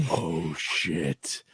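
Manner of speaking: low, soft, imitated dread; stunned dread. Viewer hearing a cacophony of screams, hushed dread. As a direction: drop low and hushed, as if horrified. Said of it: "and you're just like".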